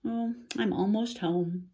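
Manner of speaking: ordinary delivery, nothing marked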